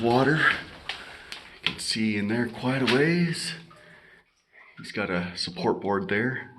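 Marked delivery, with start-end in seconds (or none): none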